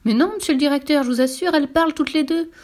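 high, loud, insistent tramp; desperate assurance; pleading protest. Acted. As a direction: protest your honesty urgently.

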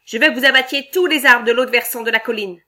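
loud, demanding boss barking orders; bossy shout. Acted. Boss issuing harsh orders.

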